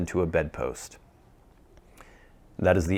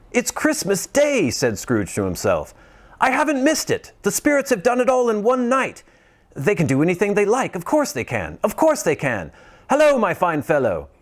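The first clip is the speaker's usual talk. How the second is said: high, fast, giddy calling out; giddy Scrooge; 11 semitones higher than usual. Acted. Scrooge shouting merry wishes to the whole world, giddy. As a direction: bubble over like a schoolboy.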